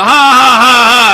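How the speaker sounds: loud high villain cackle; evil laugh. Acted. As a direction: belt out a hammy evil laugh. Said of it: "tertawa jahat".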